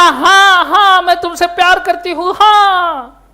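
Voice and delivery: high, loud filmy yes from Katrina; mock filmy heroine. Teacher playing Katrina accepting Sagar's proposal, mock filmy. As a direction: ham up the heroine's shy yes.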